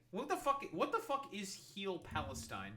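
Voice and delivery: sudden shocked outburst; disbelief. Real, not acted. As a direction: blurt it out in shock. Reacting to an unknown organization.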